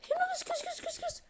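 high coaxing call to a cat; kitty-calling voice, high and soft. Acted. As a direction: call her over in a high sing-song. Calling an animal in game.

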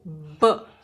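ब with lips nearly closed, a little pressure, air released; lip burst b. Shown as a demonstration. Teacher correcting the wrong way: ब pushed out from closed lips. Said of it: "होंठ थोड़ा बंद करके ... हवा छोड़ेंगे".

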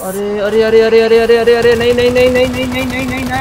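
high, loud panicked repeated cries; yelling in real panic as the enemy rushes in. Real, not acted. Gamer panicking at the swarming enemies, frantic loud cries.

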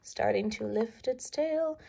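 usual speaking voice

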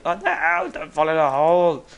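loud wailing warning; panicked yell. Real, not acted. Panic during escape.

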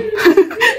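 sudden high-pitched laugh; real laugh. Real, not acted. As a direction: break into a laugh. Laughing at own story.

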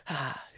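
small, soft, fading "ah"; near-whispered mock scream. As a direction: let the imitation scream shrink almost to nothing. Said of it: "it kinda almost got to a whisper".

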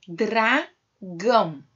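"Dragão" slowly split into dra, gão; sílaba por sílaba. Shown as a demonstration. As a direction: keep dr together, pause, then gão in "Dragão". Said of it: "você vai separar as sílabas". ("Dragão" devagar, separado em dra e gão)

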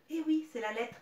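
ordinary talk

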